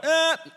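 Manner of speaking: put-on high sharp gasp of a shocked child; a small girl suddenly stops crying with a gasp; child's startled gasp.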